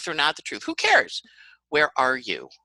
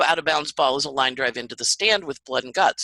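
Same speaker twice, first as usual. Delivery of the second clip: normal talking voice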